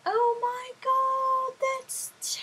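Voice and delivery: very high, drawn-out fake dismay; mock horror. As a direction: overdo the sympathy in a squeaky high pitch. Mock sympathy to a caller.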